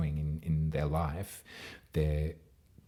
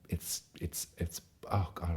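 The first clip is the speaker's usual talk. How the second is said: barely voiced, breath catching with real emotion; choked up. Real, not acted. Reader moved by the full moon reading, choked-up near-whisper. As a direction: voice catching, nearly in tears. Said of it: "I'm welling up already".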